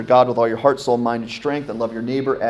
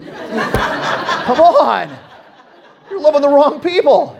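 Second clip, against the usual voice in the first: laughing, higher and louder mock protest; jokingly hurt pastor protesting, laughing and raised; mock outrage, laughing; 5 dB louder, much slower than usual. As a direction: play up the fake indignation through a laugh.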